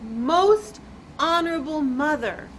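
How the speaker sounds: loud, drawn-out, syllable by syllable; very slow. Acted. Chang bows to his mother, speaking very slowly. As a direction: stretch every part of the name out deliberately. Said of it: "slowly, very slowly said".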